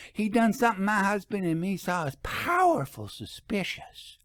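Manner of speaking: high, twangy country storekeeper gossiping; folksy shopkeeper voice. A narrator's reading. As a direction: play a gossipy rural shopkeeper.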